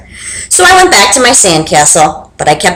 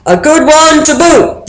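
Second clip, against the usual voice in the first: pirate crew; 6 semitones higher than usual. Acted. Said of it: "the others agreed".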